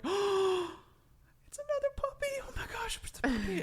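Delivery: very high, whispery squeal; delighted kids. Acted. Kids spotting another puppy, squealing in excitement. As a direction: gush breathlessly like a thrilled child. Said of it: "the kids immediately are like".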